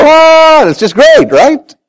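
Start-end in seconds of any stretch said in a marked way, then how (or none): 0.0-0.6 high, held heavenly "aah"; mock celestial choir note; angelic chorus "ah". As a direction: imitate rejoicing angels on one high note. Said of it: "the angels in heaven go".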